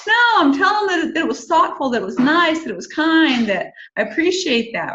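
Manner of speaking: protesting. Retold or imitated. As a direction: rush to defend yourself, voice up high. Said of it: "And I'm like".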